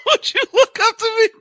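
very high words squeaked through laughter; real giggling fit; cracking up. Real, not acted. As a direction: repeat it while helplessly laughing. Cracking up at the clip.

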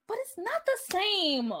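high-pitched whiny groan. A narrator's reading. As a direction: complain in a plaintive groan. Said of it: "Tia groaned".

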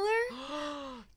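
high gasp of surprise; shocked gasp. Real, not acted. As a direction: gasp as if catching a clue. Reacts to a possible spoiler.

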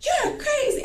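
high-pitched retort; imitated angry friend. Friend answering a call to come outside, high retold retort. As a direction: fling it back high, as the quarrelling friend.

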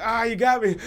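a playful fake loss to a kid; mock defeated gasp. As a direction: pretend to be pinned, groaning. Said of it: "I'm like".

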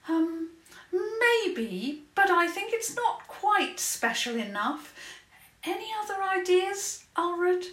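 put-on high, thoughtful girl's voice; a little girl weighing ideas; young princess voice.

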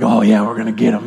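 low, fast mock team voice; pumped-up players. Players answering the coach's pep talk, mock pumped-up and low. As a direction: imitate a hyped player echoing the coach. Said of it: "you all get all pumped".

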